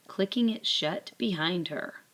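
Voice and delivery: plain reading voice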